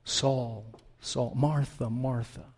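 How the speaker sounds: soft, low, tender names; tender calling. Acted. God speaking to a troubled believer, tenderly. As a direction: call each name gently, low and hushed. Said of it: "speaking to you tenderly".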